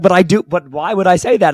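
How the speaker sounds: loud, high mock protest; retold insistent protest. Retelling a blunt reply to the player, insistent protest.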